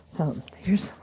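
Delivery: whispered aside; barely voiced whisper. Real, not acted. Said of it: "I'm gonna whisper for a second".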